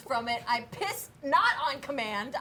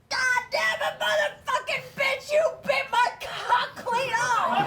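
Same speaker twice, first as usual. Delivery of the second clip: husband screaming; 9 semitones higher, slower than usual. Retold or imitated. Husband screaming at his wife, high hammed-up yell. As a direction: scream it at her in pain. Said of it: "He goes".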